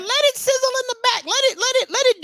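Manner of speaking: squeaky falsetto, way up high; mock high voice. Mocking the hype machine in a squeaky high voice. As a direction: pitch it way up like a cartoon.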